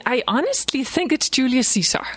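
ordinary talk, nothing marked

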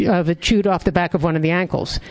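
ordinary delivery, nothing marked

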